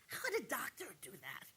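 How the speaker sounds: hushed, voiceless question; disbelieving whisper. Real, not acted. Disbelief at the doctor.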